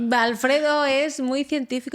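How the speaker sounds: playful slow jab at Alfredo; teasing. Real, not acted. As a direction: drag it out with amused mockery. Repeats the tease slowly.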